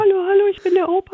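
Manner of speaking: put-on high, thin falsetto old man; fast, high grandpa voice. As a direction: squeak it out like a frail old grandpa. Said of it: "so ein bisschen fistelig".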